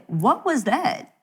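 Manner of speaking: lightly voiced God calling her out; God rebuking. God confronting the mother after school, mild stern rebuke. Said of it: "God said".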